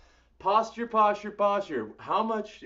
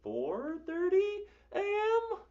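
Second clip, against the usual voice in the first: mock wail; 13 semitones higher than usual. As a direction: fake sob of self-pity. Mock cry about early hours.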